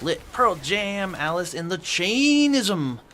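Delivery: Chainism stretched out slow with a falling end; odd drawn-out pronunciation. Real, not acted. Reading a viewer's band list in a slow silly drawl. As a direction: slowly drag out the band names, mangling Chainism. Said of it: "I don't know why I said it like that".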